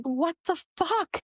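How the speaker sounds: high, drawn-out baffled question; acted bewilderment. Podcaster reacting to animals at work, acted bewilderment. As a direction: stretch out your mock disbelief, pitched high.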